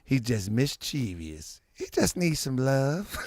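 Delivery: put-on low, slow soft old lady; a gentle grandmother excusing; grandma voice.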